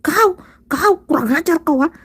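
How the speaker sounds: high, loud rough yell; furious eastern shout, loud and high. Retold or imitated.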